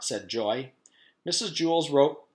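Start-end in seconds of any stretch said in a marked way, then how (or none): none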